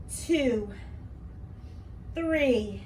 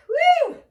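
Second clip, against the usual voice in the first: very high, loud whoop; excited whoop; 13 semitones higher, 10 dB louder than usual. Real, not acted. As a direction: shout for joy. Trainer cheering mid-set.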